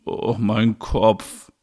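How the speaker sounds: very slow aching groan; clutching a throbbing head; hungover. Acted. Groaning about a headache.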